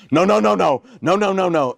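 she's digging her heels in; frantic refusal. Retold or imitated. Wife refusing to go backstage.

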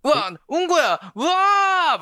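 high, loud shout of disgust; shocked shout. Retold or imitated. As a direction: recoil in disgust at the find. Acting finding it's poop.

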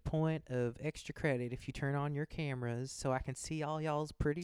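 imitating the sweet Southern teacher. Accounting teacher coaxing class to turn on cameras, lightly imitated in a Southern voice. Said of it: "she was like".

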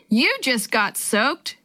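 ordinary delivery

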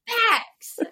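high burst of laughter; laughing. Real, not acted. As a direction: laugh loudly, then say it. Laughing at the joke.

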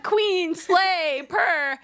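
high, sassy mock praise; mock girly slang. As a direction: hype your friend up.

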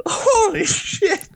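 high, drawn-out, laughing disbelief; stunned, half-laughing exclamation; amazed holy shit. Real, not acted. Amazed at the fake banknote.

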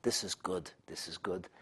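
a hint of a scheming king, soft and low; sly whisper. King savoring his plan, softly scheming. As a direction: murmur it to yourself with lightly played relish. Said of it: "And here he said".

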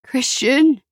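lightly acted distressed mother; mother on phone. A narrator's reading. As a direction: call his name as a mother in distress. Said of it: "Their mother's voice crackled over the phone".

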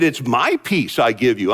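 low, slow quoted verse; slow scripture reading. Acted. Preacher quoting Jesus's gift of peace, low and slow. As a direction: lay each word down slowly. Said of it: "He said".

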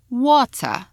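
water with a crisp T, British style. Shown as a demonstration. A teacher contrasting the British T in water. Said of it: "So in British English".

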